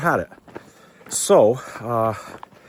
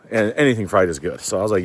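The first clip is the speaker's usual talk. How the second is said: ordinary talk, nothing marked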